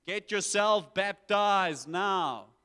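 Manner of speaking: loud, drawn-out imitated scream; screaming wilderness preacher. John the Baptist to the people in the wilderness, screaming. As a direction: holler it slowly, like a wild prophet. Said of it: "the guy in the wilderness screaming".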